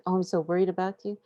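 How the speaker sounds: anxious owner fussing aloud; mock worried fuss. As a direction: gush worry at the animal. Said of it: "You just said".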